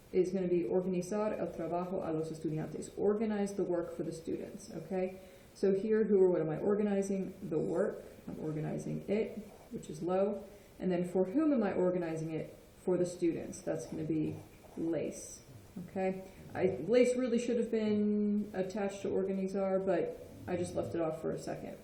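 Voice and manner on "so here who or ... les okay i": ordinary talk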